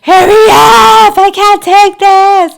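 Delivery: high, loud, slow crying scream; screaming in labor. Retold or imitated. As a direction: scream it out through labor pain. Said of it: "I was even screaming at one point".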